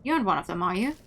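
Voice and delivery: ordinary talk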